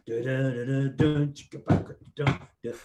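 vocal drum groove, rhythmic and punchy; drum beat imitation. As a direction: mouth the drums with clicks and thumps. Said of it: "He says, "Right, it starts".

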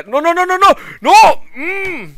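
high, loud desperate no; panicked scream. Real, not acted. Gamer dying again, screaming high and loud. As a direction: scream it as everything goes wrong.

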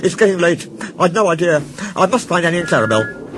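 high-pitched, flustered excuses; panicked excuse. A narrator's reading. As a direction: make nervous excuses to get away. Thomas flees the supposed ghost.

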